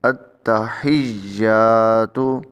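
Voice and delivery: التحیاتو with the ha pressed hard; stress the ha. Shown as a demonstration. Teacher drilling the ha, stressed and drawn out. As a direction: lean on the ha in التحیاتو, slow. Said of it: "ہا کو زور دے کے پڑھیں".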